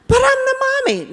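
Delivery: put-on a four-year-old insisting on her role; indignant little girl. Girl protests teacher.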